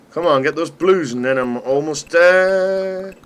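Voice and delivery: will the game to go your way; urgent pleading. Real, not acted. Urging himself on in game.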